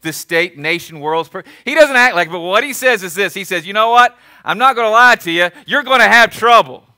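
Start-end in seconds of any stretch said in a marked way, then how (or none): 3.7-6.8 loud, frank heads-up; blunt warning. Acted. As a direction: give it to them straight and loud. God voiced giving hard truth.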